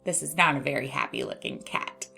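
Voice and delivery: ordinary talk, nothing marked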